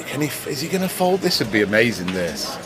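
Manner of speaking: ordinary delivery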